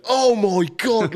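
high, slow, stunned "oh my God"; drawn-out horrified exclamation; shocked gasp. Retold or imitated. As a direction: imitate nurses gasping in horror. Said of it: "all I heard was".

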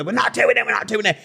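high-pitched imitation of stroppy refusal; mock refusal. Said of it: "They both kicked off so bad they refused".

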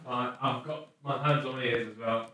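distant voice from across the room; calling from the other side of the room; far from the mic. Real, not acted. Partner ran across the room.

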